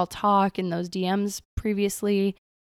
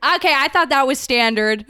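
giggly, higher and louder mock protest; laughing mock defense; 4 semitones higher, 6 dB louder than usual. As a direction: laugh it out like it's obvious.

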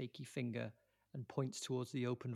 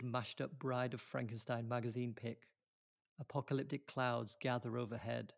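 ordinary talk, nothing marked

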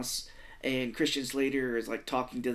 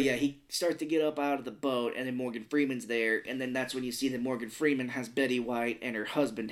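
ordinary talk

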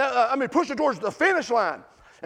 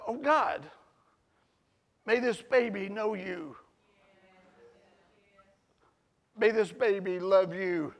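soft, slow, lower reverent prayer; hushed prayer; 5 semitones lower, 6 dB quieter than usual. Retold or imitated. Great uncle praying over his great niece's belly, soft and slow. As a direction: pray it gently over the unborn baby. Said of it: "he says".

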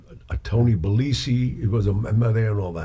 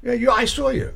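pitched way up, accusing jab; jealous accusation; 13 semitones higher than usual. Retold or imitated. Mob friend confronting the singer over his girlfriend, jealous accusing jab. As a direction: jab it like a jealous tough guy catching you.

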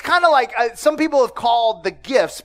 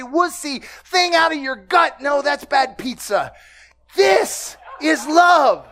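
full-force preaching; 4 semitones higher, slower than usual. Acted. Pastor shouts the psalm's promise to the congregation. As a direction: preach it out to the room.

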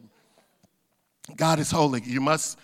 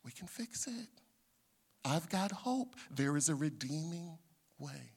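gentle reassurance; 2 semitones lower, 9 dB quieter than usual. Acted. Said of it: "God saying".

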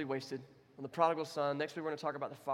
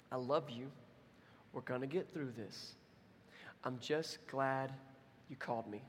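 tender God; 2 semitones lower, 6 dB quieter than usual. Retold or imitated. God's tender words.

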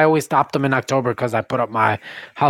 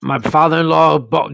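ordinary talk, nothing marked

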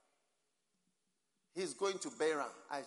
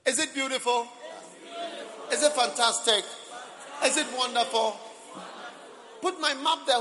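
loud, high shouting questions; yelling across the room; 9 semitones higher, 11 dB louder than usual. Real, not acted. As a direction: shout it out to the whole hall.

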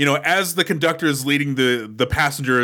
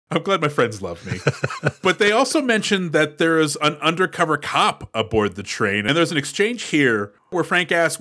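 usual speaking voice

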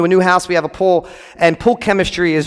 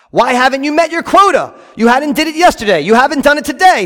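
demanding slave drivers; 7 semitones higher than usual. Acted. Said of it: "they demanded".

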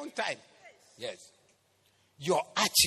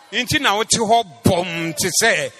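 very loud, high, driving sermon shout; full-force shouted preaching; 6 semitones higher, 17 dB louder than usual. Real, not acted. Preacher denouncing dead bodies in the church, shouted preaching. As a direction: thunder it out to the whole congregation.

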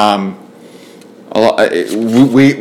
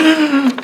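sudden high laugh breaking out; can't hold back a laugh; high burst of laughter. Real, not acted. Breaks up at the mock formality.

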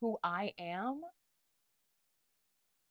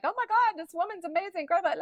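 high, loud, breathless gushing; excited gushing; 10 semitones higher, 6 dB louder, faster than usual. Retold or imitated. Raving to her ex about an amazing author, high excited gushing. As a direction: gush like you just found your hero. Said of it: "I was so excited".

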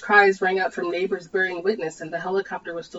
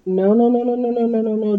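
higher, rapid panicked no's; stammered; 5 semitones higher, 3 dB louder than usual. Acted. Dee stammers at the helicopter, higher and panicked. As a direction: stumble over the no's in panic. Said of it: "Dee stammered".

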